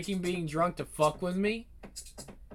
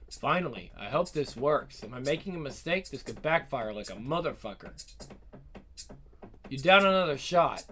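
plain reading voice

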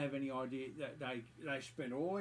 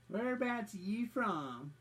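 sing-song mocking voice, pitched higher; mock prying question; 8 semitones higher, 4 dB louder than usual. Nosy AA members prying into his origins, mocked high and sing-song. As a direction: mimic the busybody prying.

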